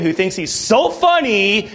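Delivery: ordinary delivery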